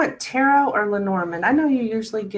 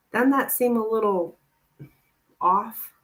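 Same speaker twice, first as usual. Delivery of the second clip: normal talking voice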